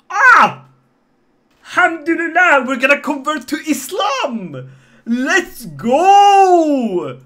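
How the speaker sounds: loud, high whoop of victory; triumphant shout. Real, not acted. Streamer cheering the poll result to chat, loud whoop.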